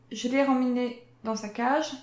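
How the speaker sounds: ordinary talk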